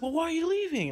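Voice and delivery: high mimicked questioning; imitated protest. Stranger asking why he is leaving, imitated high protest.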